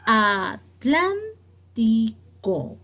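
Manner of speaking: Atlántico very slowly in separate syllables, "tlán" stressed; teacher sounding out Atlántico with long pauses between syllables. Shown as a demonstration. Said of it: "¿Cuál es la sílaba tónica?".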